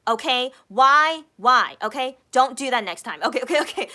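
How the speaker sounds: loud, mock-scolding Okay and Why; scolding shout. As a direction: bark it like a stern big sister. Scolding Kian over the remote.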